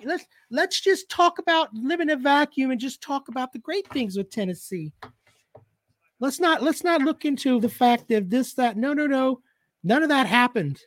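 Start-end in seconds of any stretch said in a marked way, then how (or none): 0.0-9.4 high mocking whine; mocking fan voice. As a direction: mimic the fans with a sneer. Mocking Tennessee fans' talk.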